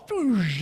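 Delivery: vocal sound effect of leaping overboard; imitated whoosh. Shown as a demonstration. Imitates Peter diving off the boat.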